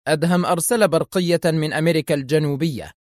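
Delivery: ordinary delivery, nothing marked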